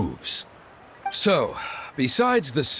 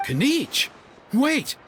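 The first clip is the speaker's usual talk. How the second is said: sharp high startled cry, then a rising "Wait!"; 11 semitones higher, slower than usual. Acted. Father startled when his daughter names Kinich, high-pitched cry. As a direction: catch the name and stop short in shock.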